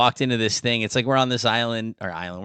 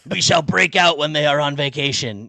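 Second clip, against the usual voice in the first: cats plotting a grand escape, a touch louder; rebel cat voice; 3 semitones higher, 3 dB louder than usual. Pretend. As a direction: speak as a pompous cat rallying the others.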